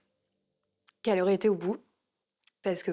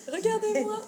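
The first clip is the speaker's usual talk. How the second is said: smug show-off; 13 semitones higher than usual. Retold or imitated. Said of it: "Elle est là, genre toute fière".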